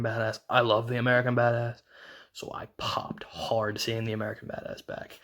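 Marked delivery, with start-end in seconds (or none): 2.3-5.2 breathy hushed whisper, softer; whispered aside to the viewers. Real, not acted. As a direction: confide it under the breath. Hushed aside about popping.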